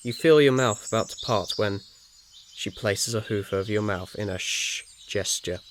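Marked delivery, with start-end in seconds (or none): none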